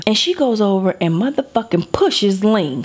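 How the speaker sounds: usual speaking voice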